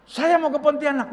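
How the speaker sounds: high, loud declaration; eager announcement. Retold or imitated. Old pastor tells Ton he will visit, eager and loud. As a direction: imitate an old pastor announcing his plan loudly.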